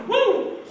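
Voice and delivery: very high "woo"; sudden playful high-pitched whoop; imitated ecstatic whoop. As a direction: whoop as if struck by the Spirit. Imitates being hit by God's power.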